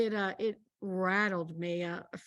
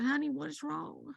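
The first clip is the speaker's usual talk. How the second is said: tender question; 5 semitones higher, 6 dB quieter than usual. Retold or imitated. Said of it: "I said".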